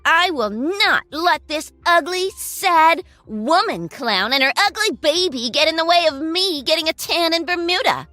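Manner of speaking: spiteful. Acted. Girlfriend insulting the ex.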